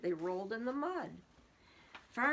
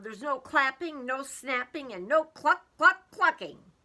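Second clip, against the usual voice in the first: louder, firm farmer listing rules; stern list of rules; 5 semitones higher, 10 dB louder than usual. Acted. Farmer scolding excited cows, firm and loud. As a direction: lay down the law to the cows. Farmer lists what is banned.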